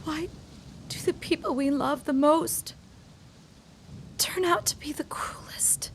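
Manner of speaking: whispered, trailing into grief; heartbroken whisper. Acted. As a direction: let it fade in pain. Diana alone, heartbroken.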